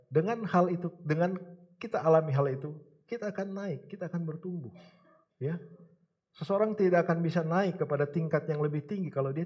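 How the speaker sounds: rising sermon. Acted. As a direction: build it up for the congregation. Preaching builds again.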